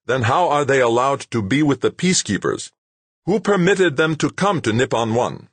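high, loud demanding questions; angry demand. A narrator's reading. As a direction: demand answers in anger. Rashid demanding.

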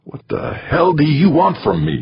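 angry, high-pitched snarl. A narrator's reading. Man grasping her wrist at the table, snarling. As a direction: snarl the demand, pitch jumping up. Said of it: "he snarled".

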